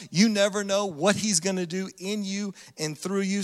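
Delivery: ordinary talk, nothing marked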